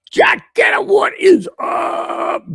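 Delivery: very high, stretched shout calling out a name; excited greeting. Real, not acted. Podcast host hailing a chat regular, very high stretched shout.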